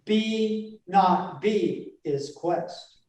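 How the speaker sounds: slow, separate beats on BE, NOT, BE, IS, QUEST; stressed syllables only. Shown as a demonstration. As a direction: punch out "be", "not", "be", "is", "quest" one by one. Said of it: "And the unstressed lines are".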